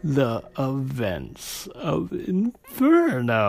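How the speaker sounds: slow, drawn-out creepy horror host. Acted. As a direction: drag every word out like a ghoulish host. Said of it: "creepy voice the entire podcast".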